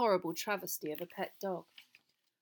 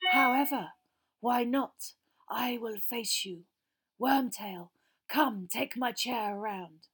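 cold villain, raised then slowing; cold, commanding master; 3 semitones higher, 4 dB louder, slower than usual. A narrator's reading. Master agreeing to face Frank, cold commanding voice. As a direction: give the order with deliberate menace.

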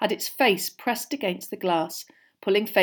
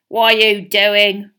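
slow, loud, accusing snarl; drawn-out menacing question; suspicious snarl; 12 dB louder, slower than usual. A narrator's reading. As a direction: challenge him slowly, full of suspicion. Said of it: "Filch snarled suspiciously".

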